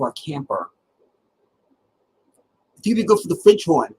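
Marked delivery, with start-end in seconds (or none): 2.8-3.9 higher, mock-eager band director; a spitting, excited teacher pitch; imitating the band director. Band director recruiting a kid, higher mock voice. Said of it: "he would say".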